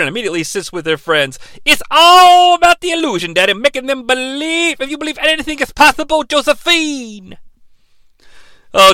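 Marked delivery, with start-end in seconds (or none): 1.6-7.4 Dusty Rhodes impression. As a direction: hype it like an old wrestling legend cutting a promo. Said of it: "[name]'s Dusty Rhodes".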